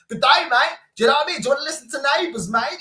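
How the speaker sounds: high, loud Australian good day mate; teasing Australian accent; mock Australian accent. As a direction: ham up an Aussie accent.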